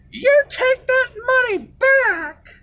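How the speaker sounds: mock his mother ordering him, drawn out; mom's stern order. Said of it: "So she said".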